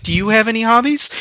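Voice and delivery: retold flirty question. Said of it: "and she's like".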